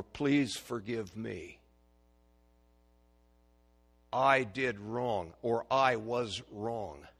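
very slow, each word weighed; slow, careful apology. Shown as a demonstration. Pastor modeling hard words, slow and deliberate. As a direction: say it slowly and mean it. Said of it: "so hard to say".

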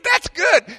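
high, loud delighted reaction; amused approval. Retold or imitated. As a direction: imitate someone tickled by a comeback. Laughing driver's delighted approval.

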